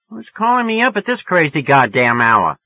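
woman woken by a late call, irritated. A narrator's reading. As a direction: snap at the caller. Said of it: "none too friendly either".

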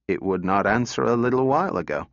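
usual speaking voice

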